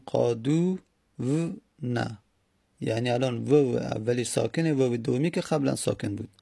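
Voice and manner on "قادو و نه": "قادو و نه" broken into separate sounds, with pauses; sillabato. Shown as a demonstration.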